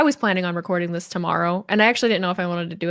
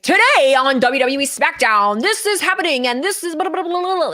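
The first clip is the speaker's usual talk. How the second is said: big, high sports-show announcer; hammed-up TV wrestling broadcast intro; broadcaster voice; 6 semitones higher, 5 dB louder than usual. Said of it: "having a broadcaster voice".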